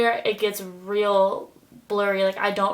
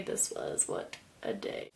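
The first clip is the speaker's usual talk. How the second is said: whispered sigh; 10 semitones lower, 15 dB quieter, slower than usual. Real, not acted. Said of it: "reflecting on the awful day".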